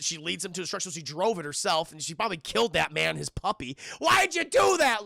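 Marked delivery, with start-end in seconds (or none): 4.0-5.0 mock outraged yell.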